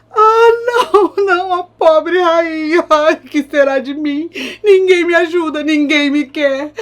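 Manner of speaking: high, loud crying lament; hammed-up sobbing despair; mock wailing. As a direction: wail that nobody wants her. Queen laments.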